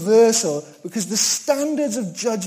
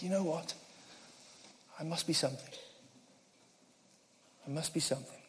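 much softer, self-satisfied murmur; smug inner voice; 19 dB quieter, faster than usual. Retold or imitated. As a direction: say it to yourself, quietly pleased. Proud inner thought voiced.

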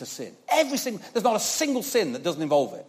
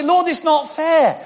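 whiny complaint; 11 semitones higher, 6 dB louder, slower than usual. Retold or imitated. Complaint to God.

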